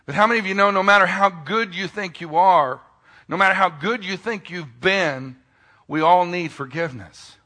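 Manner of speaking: loud, high preaching build; full-force preaching. Acted. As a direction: preach it out to the whole room.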